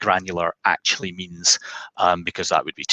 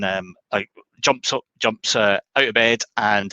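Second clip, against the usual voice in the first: ordinary talk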